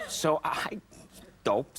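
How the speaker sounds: ordinary talk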